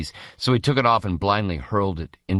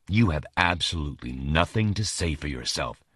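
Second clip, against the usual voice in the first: bitter; 3 semitones lower, 4 dB quieter than usual. Acted. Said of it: "with unexpected bitterness".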